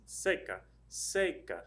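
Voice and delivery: "seca" demonstrated with a clear stress on SE. Shown as a demonstration. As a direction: punch the first syllable of "seca". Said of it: "The stress is over that E".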